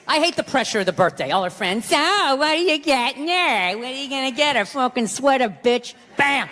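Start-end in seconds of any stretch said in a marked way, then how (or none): 1.8-5.9 mocking singsong. Mocking wife's friends, then his answer.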